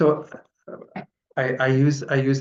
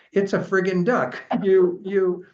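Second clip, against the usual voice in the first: high, loud mock exasperation breaking into a laugh; exasperated outburst; 9 semitones higher, 5 dB louder, slower than usual. A narrator's reading. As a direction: blow up at your partner, half laughing. Acted quarrel at its peak.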